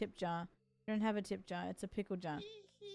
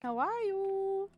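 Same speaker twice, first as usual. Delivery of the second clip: sweet greeting voice; 10 semitones higher, much slower than usual. Acted. Voicing a sweet NPC greeting.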